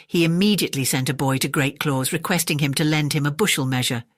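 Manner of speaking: ordinary delivery, nothing marked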